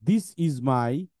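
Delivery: very slow, word by word; slow model reading. Shown as a demonstration. Teacher models sentences for pupils to read back, very slowly. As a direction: read each sentence slowly and deliberately, pausing between phrases. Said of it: "আমি একটু পড়াই দেই".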